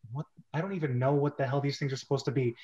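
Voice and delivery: normal talking voice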